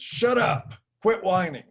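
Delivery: high, loud retort imitating an impatient scolder; imitated dismissive outburst; mock angry snap. Mocking dismissal of men's complaints.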